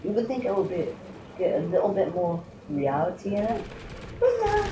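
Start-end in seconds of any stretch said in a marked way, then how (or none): 4.2-4.6 mocking tone. Real, not acted. Sarcastic complaint about the game.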